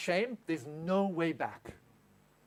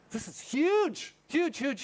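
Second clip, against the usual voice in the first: HUGE stretched slow and pitched high; "huge" punched high, then repeated; emphatic; 6 semitones higher, much slower than usual. Real, not acted. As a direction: hammer the word "huge" with rising excitement. Stressing how big the point is.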